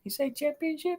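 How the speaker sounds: put-on high, soft sing-song to a baby; baby talk. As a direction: coo it to an infant. Talking to his newborn baby.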